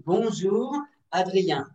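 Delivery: drawn-out slow Bonjour Adrien; slow teacher demo greeting; slow model greeting. Shown as a demonstration. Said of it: "la même intonation".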